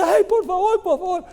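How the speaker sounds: wailing plea. Acted. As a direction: beg pitifully like a wailing woman. Deudor sigue rogando. (súplica lastimera, mucho más aguda y fuerte)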